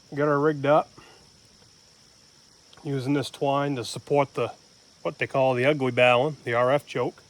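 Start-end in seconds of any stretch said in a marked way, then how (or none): none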